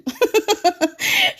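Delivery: giggling fit; high laugh. Real, not acted. Host laughing at Sandra's comment, high giggle. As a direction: laugh at a friend's joke. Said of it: "Je rigole, Sandra".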